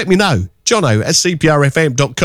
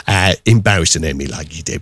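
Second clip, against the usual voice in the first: ordinary talk, nothing marked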